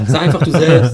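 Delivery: laughing through the words. Real, not acted. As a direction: crack up mid-sentence. Repeats advice, breaking into laughter.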